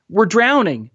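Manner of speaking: high-pitched desperate appeal; begging. A narrator's reading. Boy in the sea to dinghy passengers, begging. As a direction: plead urgently, as if in danger. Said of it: "Mahmoud begged".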